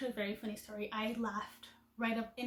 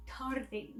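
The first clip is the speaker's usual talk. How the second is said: "tarde" with an English R and an "ay" ending, English accent. Shown as a demonstration. Admitting her usual slip to viewers, English-accented "tarde". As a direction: say "tarde" like an English speaker would. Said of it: "very English".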